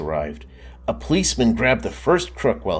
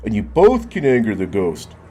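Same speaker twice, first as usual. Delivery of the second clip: put-on higher, louder complaining kid; a whiny objection; Marcel protesting; 3 semitones higher, 5 dB louder than usual. Marcel refuses to go back inside, higher louder protest. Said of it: "said Marcel".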